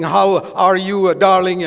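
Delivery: mock preacher voice. Shown as a demonstration. Preacher absurdly greeting his wife as if preaching, mock pulpit delivery. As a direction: parody pulpit bombast, punching each word. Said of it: "they don't speak like that to their wife".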